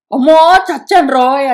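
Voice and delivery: loud anguished cry of despair; loud wail. Acted. Kunti rushing to the scene, loud wailing cry. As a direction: wail it out at full voice. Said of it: "గట్టిగా వికృస్య విశేషంగా గట్టిగా".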